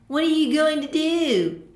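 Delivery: high, loud snide bully taunt. A narrator's reading. A bigger bully taunting young Sunset, snide and loud. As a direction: sneer down at the little filly. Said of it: "the snide answer".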